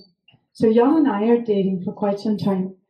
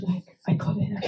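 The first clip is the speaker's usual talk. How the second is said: whisper; 6 dB quieter than usual. Real, not acted. Whispered aside in the story.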